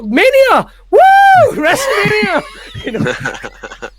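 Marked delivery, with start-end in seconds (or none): 0.0-2.4 high, loud fan whoop; hammed-up wrestling crowd shouts; mock hype. Forcing cheer at the wrestling event, mock hype.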